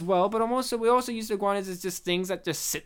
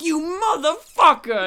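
high, loud mock outburst at the trickster; hammed-up furious shout; betrayed hero yelling in rage; 8 semitones higher, 8 dB louder than usual. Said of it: "he's like".

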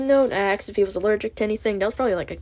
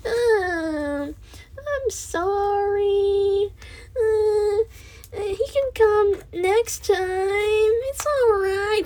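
very high, slow, syrupy condescending voice; mock a patronizing receptionist, sugary and high; imitating the condescending therapy lady who missed them, very high and slow; 12 semitones higher, much slower than usual. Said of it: "being real condescending about it".

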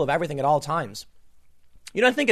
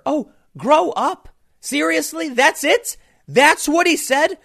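high, slow disbelieving outburst; can't believe this is the complaint; incredulous; 11 semitones higher, 5 dB louder, slower than usual. Real, not acted. Host reacting to the makeup-room remark, incredulous.

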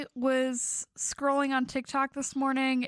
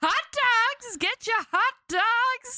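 higher hawker's call; stadium vendor hawking; 6 semitones higher than usual. Pretend. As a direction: holler hot dogs to the crowd. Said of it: "vendor calls".

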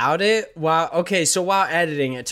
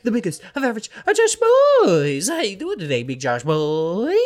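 hyped host introduction; 5 semitones higher, 3 dB louder than usual. Real, not acted. Podcast host presenting the co-host, hyped up. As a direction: stretch the name out loud and high.

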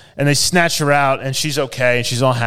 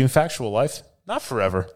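ordinary delivery

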